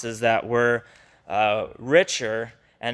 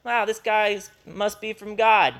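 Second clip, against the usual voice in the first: casual mock wow; 7 semitones higher than usual. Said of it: "He's not just going".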